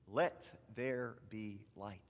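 slow lead-along. Shown as a demonstration. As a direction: space out each word for children to repeat. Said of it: "Can you say".

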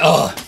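loud, heavy mock groan; tired groan. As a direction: collapse into an exaggerated "ugh". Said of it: "we get tired".

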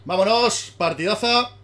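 ecstatic celebrating. Real, not acted. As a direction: scream with victory joy. Celebrating a win in game.

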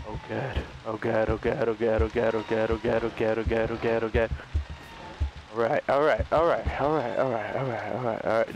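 low frantic repeated muttering; terrified gibbering. Real, not acted. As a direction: chant it to calm your nerves. Terrified looping corridor.

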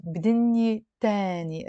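alif as open 'ah' in تانية; sung-style vowel in تانية, 'taa-ni' not 'teh'; song pronunciation. Shown as a demonstration. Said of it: "pronounce the alif as a a".

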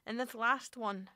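ordinary delivery

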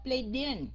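BLADE IN joined with no stop, like "blade-din"; wrongly linked. Shown as a demonstration. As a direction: glue blade onto in. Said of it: "không được đọc". (blade in đọc dính liền thành "blây-đin")

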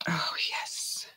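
soft, whispered satisfaction; whispered delight. Real, not acted. Crafter admiring her finished potion, whispered delight. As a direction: drop to a pleased whisper.

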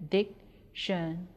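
"dictionary" split into four separate syllables. Shown as a demonstration. Teacher breaking "dictionary" into syllables for pupils, measured and separated. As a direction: break "dictionary" apart, pausing after each syllable. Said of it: "When we break dictionary into syllables".

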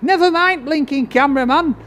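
high mock complaint about the cameraman; mock jealous outburst. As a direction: play at being fed up with the cameraman.

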